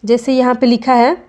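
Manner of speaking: ordinary talk, nothing marked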